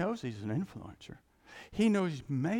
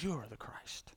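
whispered, soft and reverent; hushed confession; 4 semitones lower, 12 dB quieter than usual. Retold or imitated. Peter confesses to Jesus in an awed whisper. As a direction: breathe it out like a secret.

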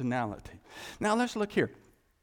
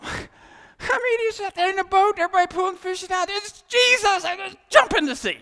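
much higher and louder, racing; excited exclaiming; 10 dB louder than usual. Acted. Preacher marvels at Peter leaping from boat, loud and high. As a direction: burst out in amazement.

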